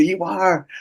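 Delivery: insistent retort. Retold or imitated. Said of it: "they start swearing at me".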